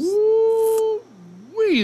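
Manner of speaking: high, loud delighted whoop; excited whoop. Real, not acted. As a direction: let out a happy holler. Host reacts to grand prize.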